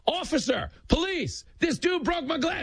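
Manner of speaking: high-pitched mock yell for help. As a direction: holler like a scammer calling police. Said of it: "just starts yelling".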